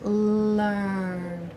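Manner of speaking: one long, stretched word; sounding out. Shown as a demonstration. Teacher helps class hear letters in a word, sounding it out slowly.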